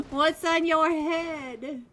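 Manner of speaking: high burst of laughter, words through it; laughing out loud. Real, not acted. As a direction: crack up at the odd headgear. Laughing at player's hat.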